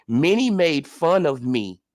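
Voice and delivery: low, slow, mock-humble voice; mocking impression of Ugas. Host mocks boxer's statement in low, slow, mock-humble voice. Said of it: "let's read it again".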